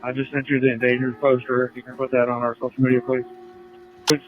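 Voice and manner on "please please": ordinary talk, nothing marked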